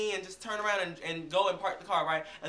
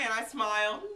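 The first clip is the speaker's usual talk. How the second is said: ordinary talk, nothing marked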